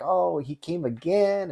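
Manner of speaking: high, slow, teasing mock voice; mocking classmates. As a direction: classmates laughing at Jim on the video, teasing. Classmates mocking him on video.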